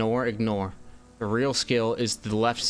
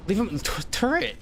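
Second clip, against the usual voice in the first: excited yelp; 8 semitones higher than usual. Real, not acted. Shouting at turret.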